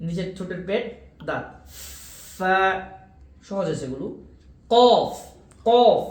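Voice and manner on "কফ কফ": কফ punched loud from the tongue root; Arabic কফ demo. Shown as a demonstration. Teacher demonstrating কফ loudly to students. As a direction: bark out কফ from the back of the tongue. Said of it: "এটা হচ্ছে জিহ্বার গোড়া".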